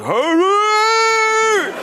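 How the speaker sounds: high, long wailing hurry. Retold or imitated. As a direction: wail it like a distant rock singer. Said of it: "he sounds far away".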